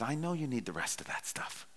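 soft hushed whisper. Retold or imitated. God reassuring the worried pastor, hushed whisper. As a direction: breathe it like a gentle secret. God speaking gently in story.

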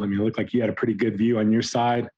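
ordinary talk, nothing marked

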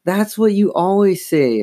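high, exasperated complaint; groaning complaint. A narrator's reading. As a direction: complain it high, fed up with Mom. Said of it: "Chris groaned".

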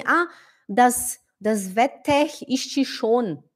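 slow "das Wetter ist schon" as "vetekh isti shon"; wrong pronunciation. Retold or imitated. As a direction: mispronounce "Wetter" with a harsh final r and "ist" as "isti". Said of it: "Se você fala assim". (pronúncia errada, com sotaque brasileiro)

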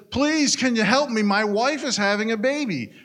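high, loud, desperate asking; panicked plea. Acted. Joe knocking at the neighbour's trailer, panicked and high. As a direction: beg the neighbour for help in a panic. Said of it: "So in a panic".

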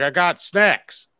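put-on slow, high, loud slurred drunk voice; drunk buddy. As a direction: drag it out like a proud drunk friend. Imitating drunk Tice's answer.

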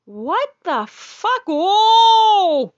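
slow stunned "what the fuck" then a loud high cry; shocked gasp. Retold or imitated. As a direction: horror building to a cry. Sees telescope, shocked.

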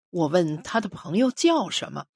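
usual speaking voice

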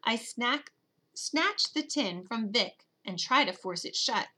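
ordinary delivery, nothing marked

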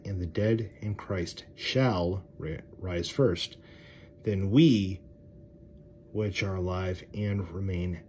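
loud, slow, solemn Bible reading; preacher reading scripture out loud. Acted. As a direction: proclaim the verse slowly to the congregation. Reads scripture aloud.

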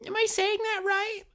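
very high, cartoonish teacher checking herself; teacher asking after mangling the name; mock teacher. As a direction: push the fake innocence to a squeak. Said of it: "Of course, um".

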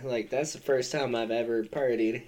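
ordinary talk, nothing marked

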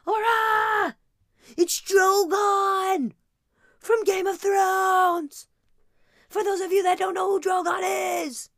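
put-on little girl yelling about monsters; screaming child. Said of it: "that little girl would have been like".

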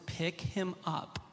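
slow, soft, weighted command; Lord's repeated command. Retold or imitated. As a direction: spell it out word by word, quietly insistent. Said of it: "the second time, the Lord says".

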